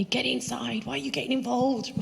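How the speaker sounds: high-pitched mock yell of a dad; imitating dad shouting. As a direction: act out an alarmed father's scolding. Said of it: "My dad's shouting at me".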